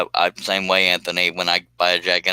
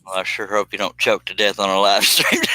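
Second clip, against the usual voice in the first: usual speaking voice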